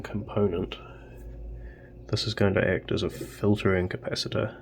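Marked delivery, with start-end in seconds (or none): none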